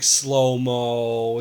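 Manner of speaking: very slow, stretched out; slow-motion drawl. Shown as a demonstration. Film podcaster describing a love scene, dragged out slow.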